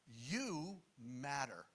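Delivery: "You" drawn out slowly, then "matter"; emphatic YOU. Retold or imitated. Jesus to a normal group of people, slow emphatic "You". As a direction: stretch "You" and let "matter" fall. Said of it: "it's emphatic".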